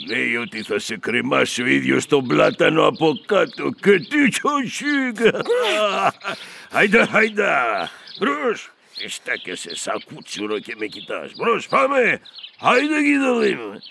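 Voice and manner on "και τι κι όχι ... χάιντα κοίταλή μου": bully him forward with a laugh; gloating soldier herding a prisoner; mocking taunt. Guard taunts and drives captive.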